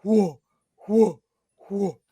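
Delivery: three loud, short hoots; loud creature call. Acted. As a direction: repeat the hoot loudly, evenly spaced. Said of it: "It's quite loud".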